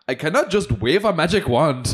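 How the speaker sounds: high-pitched mock Indian accent; Seema Auntie. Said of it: "of Seema Auntie being like".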